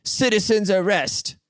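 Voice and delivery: high, slow hammed-up cry; mock a busybody calling out, high and slow; mock citizen's arrest. Podcaster mocks a wannabe hero, hammed-up high call. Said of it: "He goes".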